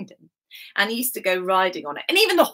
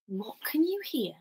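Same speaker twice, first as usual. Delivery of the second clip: hushed whisper; 8 dB quieter than usual. Real, not acted. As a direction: whisper it to make them listen. Hushes children to listen.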